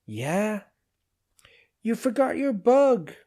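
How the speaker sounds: high, tinny little-machine voice; smug squeak from the drawer; squeaky machine voice. A narrator's reading.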